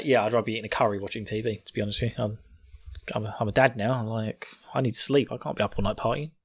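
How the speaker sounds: ordinary talk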